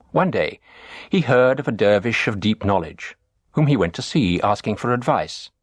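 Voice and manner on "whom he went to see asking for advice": ordinary delivery, nothing marked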